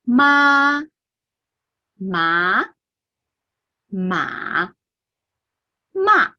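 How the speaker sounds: ma level, rising, dipping, then sharply falling; the four tones of ma in a row; all four tones. Shown as a demonstration. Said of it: "I will say it all together".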